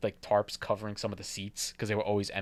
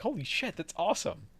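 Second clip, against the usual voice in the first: high, amazed exclamation; excited; 8 semitones higher, 4 dB quieter than usual. Retold or imitated. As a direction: gush with sudden wonder. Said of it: "I'm like".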